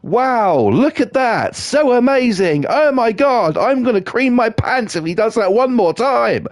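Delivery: high, gushing fake excitement; high hammed-up infomercial gushing; mock hard sell. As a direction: overdo the amazement like a paid presenter. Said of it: "give it the hard sell".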